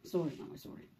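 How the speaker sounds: low, hushed sorry; quiet, low soothing; soft apology. Real, not acted. Comforting crying child softly.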